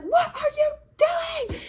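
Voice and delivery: high, slow shouted alarm. Retold or imitated. Man spots Julia on the roof edge, shouted alarm. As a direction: yell across the rooftop to stop her.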